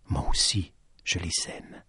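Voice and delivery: low, hushed and soft; quiet confession. Acted. The lady confides to Petit Jean, quiet and low. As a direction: let it sink almost to nothing.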